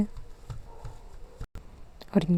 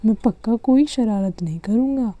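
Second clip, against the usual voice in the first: ordinary delivery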